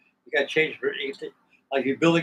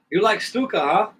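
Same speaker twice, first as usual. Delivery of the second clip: higher-pitched imitation of a German host; lightly put-on German accent, pitched up; 6 semitones higher, 3 dB louder than usual. German host asking about his Stuka, light German accent.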